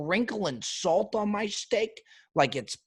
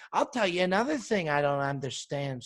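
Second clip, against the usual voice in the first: normal talking voice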